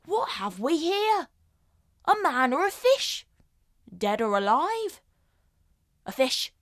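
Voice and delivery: animated, theatrical Shakespearean clown; big swooping pitch, relishing each discovery; comic Trinculo. Acted. Trinculo finding a strange fishy creature in a storm, comically astonished. Performs Trinculo's monologue from The Tempest.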